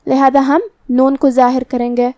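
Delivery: usual speaking voice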